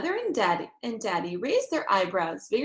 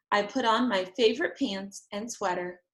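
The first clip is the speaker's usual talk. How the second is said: plain reading voice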